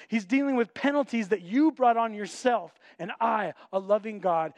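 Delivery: fervent preaching. Acted. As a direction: plead with the crowd from the pulpit. Voices God's call, preaching.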